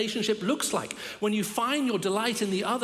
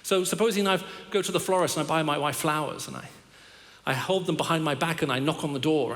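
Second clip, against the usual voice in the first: ordinary talk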